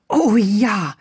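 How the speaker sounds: loud, eager exclamation; delighted. A narrator's reading. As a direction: jump at the idea with glee. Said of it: "leuchteten Lillys Augen auf".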